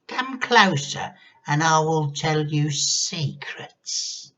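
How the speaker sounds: very high, slow, sing-song lure; grandma luring George closer; luring witch. A narrator's reading. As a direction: beckon him with secrets. Witchy coax.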